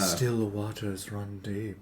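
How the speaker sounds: lightly voiced, low and measured quote; quoted movie line. Stepmom remarks on quiet Anna, slow low voice.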